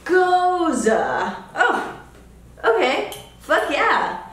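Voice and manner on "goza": Goza with a long, high O; drawn-out pronunciation demo. Shown as a demonstration. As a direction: stretch GOZA, stressed O, ending in uh. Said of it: "with the emphasis on the O".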